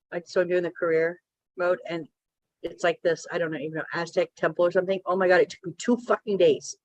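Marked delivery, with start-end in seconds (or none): none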